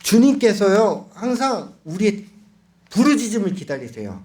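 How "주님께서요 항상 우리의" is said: usual speaking voice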